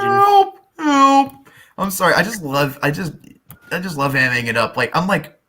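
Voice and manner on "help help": high, loud yelps of help; cry for help. Acted. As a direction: yell help in mock panic.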